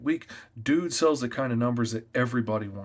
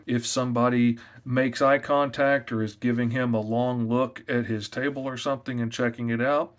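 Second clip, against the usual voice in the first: ordinary talk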